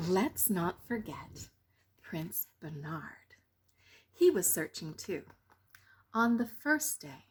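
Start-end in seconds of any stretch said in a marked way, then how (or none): 0.1-3.4 hushed aside. A narrator's reading. Narrator introduces third prince.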